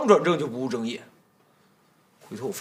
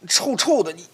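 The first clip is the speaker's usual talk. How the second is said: high, flustered fending off; flustered protest; 9 semitones higher than usual. Acted. Master fending off the clinging maid, flustered. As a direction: push her away in a fluster.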